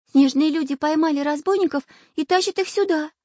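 put-on high, loud little girl voice; high-voiced little girl. A narrator's reading.

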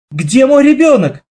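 high, loud panicked cry; screaming for her missing child; frantic mother's cry. A narrator's reading. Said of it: "закричала мама". (отчаянный крик матери)

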